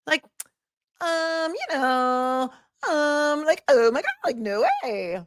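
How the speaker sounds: put-on ditzy valley girl squeal. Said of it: "talking in a Valley Girl type voice".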